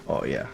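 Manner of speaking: a low moan, like finally sitting after a long march; deep relieved groan. Pretend. Relief after taking boots off.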